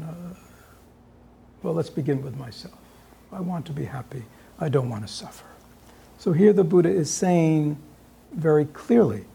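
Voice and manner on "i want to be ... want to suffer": whispered, barely voiced; soft whisper of a simple wish; hushed inner voice. Shown as a demonstration. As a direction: breathe it as a private wish. Turns the wish to himself.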